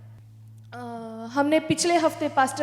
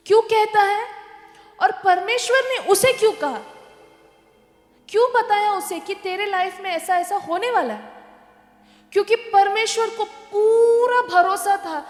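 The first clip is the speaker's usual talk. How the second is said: raised, louder preaching build; fervent preaching; 5 semitones higher, 5 dB louder than usual. Acted. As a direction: drive the question home to the congregation. Preaching rises to full force.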